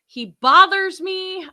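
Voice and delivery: high, slow emphasis; emphatic. Real, not acted. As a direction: lean hard on each word. Venting about host.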